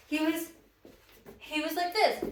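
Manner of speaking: normal talking voice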